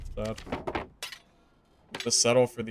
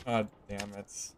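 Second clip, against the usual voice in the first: frustrated mutter; 4 semitones lower, 11 dB quieter than usual. Real, not acted. Sighing in frustration.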